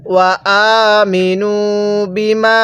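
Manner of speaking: slow chant, the মি in আমিনু held long for three alif; Quranic recitation. Shown as a demonstration. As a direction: draw out আমিনু বিমা in measured tajweed. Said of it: "এই মিমের মধ্যে আমরা তিন আলিফ টান দিব".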